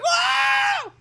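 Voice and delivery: high shouted cartoon whoa. Frog startled mid-fight, high shouted whoa. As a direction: yelp it high and loud.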